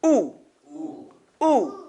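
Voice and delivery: Ooh with lips firmly rounded. Shown as a demonstration. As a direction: show the class a lip-rounded Ooh. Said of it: "Look at my lips".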